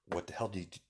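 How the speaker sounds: said softly, well under full voice; hushed inner thought. Acted. Mother wondering about her son, hushed. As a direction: murmur it as a private thought.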